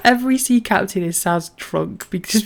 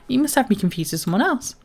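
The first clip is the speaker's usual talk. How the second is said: usual speaking voice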